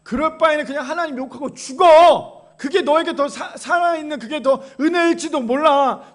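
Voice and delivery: exasperated Job's wife. Acted. Job's wife to her suffering husband, loud exasperation. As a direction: act out the wife urging him to give up. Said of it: "욥의 아내가 뭐라고 얘기합니까".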